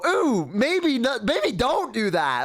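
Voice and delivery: high, slow, drawn-out wincing reaction; mock a polite, squeamish warning; squeamish hesitation.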